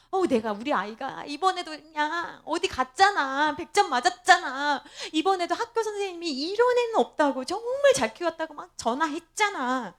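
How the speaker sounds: mock boast, pitch climbing as it runs on; bragging. Rival mother boasting to Hannah about her child, hammed-up bragging. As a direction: imitate a gushing, showy brag. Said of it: "막 자랑한다 그러면".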